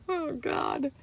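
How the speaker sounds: ordinary delivery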